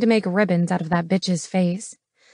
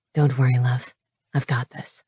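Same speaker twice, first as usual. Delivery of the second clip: calm her down gently; reassuring; 7 semitones lower than usual. A narrator's reading. Knox reassures her, low, soft.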